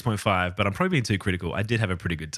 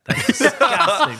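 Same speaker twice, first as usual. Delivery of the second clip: high, loud laughing groan; cracking up at a gross sound; laughing disgust; 14 semitones higher, 6 dB louder than usual. Real, not acted. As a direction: laugh through it in real disgust. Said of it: "I gotta cut that".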